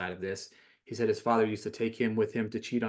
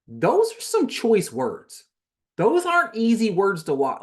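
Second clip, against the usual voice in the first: stunned; 10 semitones higher, 8 dB louder, slower than usual. Real, not acted. Shocked reaction, high and loud.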